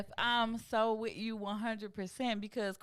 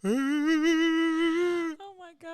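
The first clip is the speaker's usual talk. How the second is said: very slow, higher and louder oh my God; stunned; 7 semitones higher, 6 dB louder, much slower than usual. Real, not acted. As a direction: drag it out in disbelief. Reacting to crying story.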